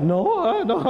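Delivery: put-on quick, high childish no; high child denial. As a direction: answer like a caught kid. Child denies to father.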